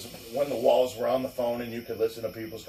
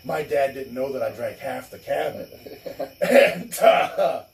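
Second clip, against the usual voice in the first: ordinary talk, nothing marked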